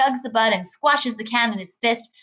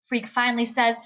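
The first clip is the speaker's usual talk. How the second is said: usual speaking voice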